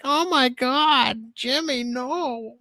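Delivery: high, slow exasperated cartoon voice; cartoon dad voice. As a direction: whine it like a cartoon parent. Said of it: "It's like".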